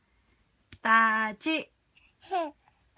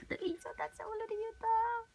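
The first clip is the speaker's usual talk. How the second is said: soft coaxing; 7 semitones higher, 9 dB quieter than usual. Shown as a demonstration. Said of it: "coaxing baby again, softer".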